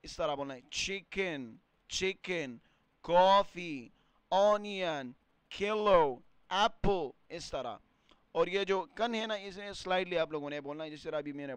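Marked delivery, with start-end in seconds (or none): 0.7-7.1 coffee, کلو and apple said louder with the first syllable hit hard; stressed first syllable. Shown as a demonstration. Teacher drilling word stress for students, first syllable of each word punched. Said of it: "stress ڈالنا ہے".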